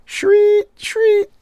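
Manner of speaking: very high, loud squealing "shriek"; shrieked sound effect. Pretend. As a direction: screech it like a comic sound effect. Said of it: "I just like saying the sound effects out loud".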